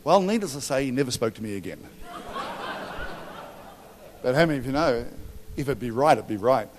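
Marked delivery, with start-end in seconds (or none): 2.0-3.5 high laugh. Real, not acted. Laughs at his own story.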